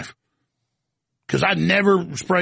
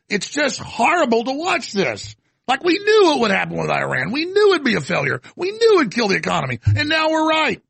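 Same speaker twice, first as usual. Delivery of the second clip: frustrated outburst; 8 semitones higher than usual. Real, not acted. Frustrated rant building up.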